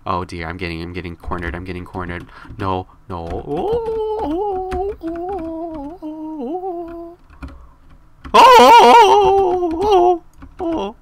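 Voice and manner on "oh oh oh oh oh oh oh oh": very high, squeaky panicked oh's; mock panic squeal. Cornered by players in the game, mock panic squeaking. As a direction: squeal like a cornered cartoon mouse.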